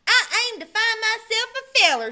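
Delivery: a bold rich gal announcing her plan; ranch gal. A narrator's reading. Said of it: "she said".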